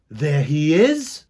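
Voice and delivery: ordinary delivery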